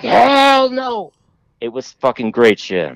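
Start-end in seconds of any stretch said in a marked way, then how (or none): 0.0-1.1 high, drawn-out mock wail; retold horror. Man a few feet past the storyteller, still mock-horrified. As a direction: imitate a terrified man, stretching "hell" way up high. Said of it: "he looked terrified of me".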